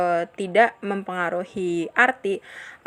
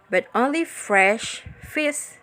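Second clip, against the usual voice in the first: slow, "fresh" drawn out and emphasized; slow down and stretch "fresh"; stress on fresh; 2 semitones higher, slower than usual. Shown as a demonstration. Teacher modelling an emphasized adjective, stressed "fresh". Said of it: "hear how the focus words are emphasized".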